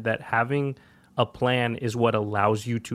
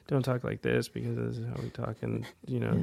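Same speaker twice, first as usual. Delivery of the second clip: low-energy, much softer mumble; nerd voice; 3 semitones lower, 10 dB quieter than usual. Shown as a demonstration. Podcaster showing others how not to talk, nerd voice. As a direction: trail off in an underpowered nerd voice. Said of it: "avoid nerd voice".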